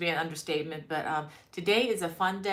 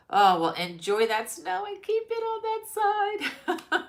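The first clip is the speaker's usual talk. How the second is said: playful tease; 12 semitones higher, 5 dB louder than usual. Real, not acted. Teasing viewer about snow.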